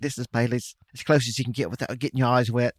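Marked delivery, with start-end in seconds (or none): 0.0-0.8 put-on soft Liverpool accent, lightly done. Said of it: "not bad for [name]".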